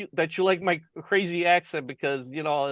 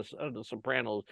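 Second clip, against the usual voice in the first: ordinary talk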